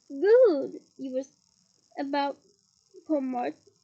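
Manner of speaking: slow and drawn out, word by word; slow drawl. Shown as a demonstration. Peeves taunts Hermione, slowly drawn out. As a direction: stretch out every word, taunting.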